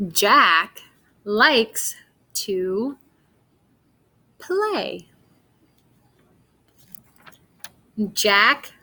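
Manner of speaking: slow, word-by-word storybook read; reading to children. Acted. Teacher reading Jack the Cat to pupils, slow and clear. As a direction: read each word apart for beginners.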